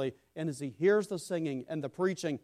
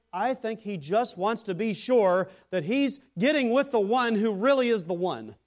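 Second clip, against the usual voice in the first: raised, higher, louder preaching; preacher driving the point to the congregation, louder; emphatic preaching; 5 semitones higher, 6 dB louder than usual. Real, not acted. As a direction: press the point home from the pulpit.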